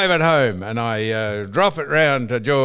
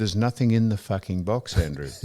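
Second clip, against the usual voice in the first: mock-polite, lower and softer, with box still said bluntly; 5 semitones lower, 4 dB quieter than usual. Claiming he was polite to the shop man, mock-polite and softer. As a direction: sweet-talk the insult as if being courteous. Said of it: "I was very polite".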